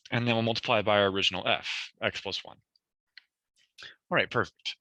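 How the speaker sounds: ordinary talk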